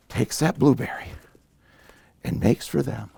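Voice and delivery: plain reading voice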